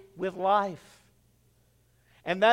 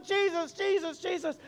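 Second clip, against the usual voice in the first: frightened cries; 8 semitones higher, 6 dB quieter than usual. Retold or imitated. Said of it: "she's screaming".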